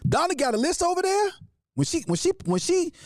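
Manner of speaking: normal talking voice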